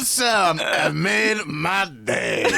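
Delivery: slow, high mock French drawl; hammy French artist voice; French accent. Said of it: "French people are so mad at us".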